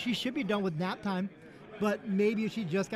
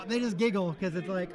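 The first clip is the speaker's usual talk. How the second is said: normal talking voice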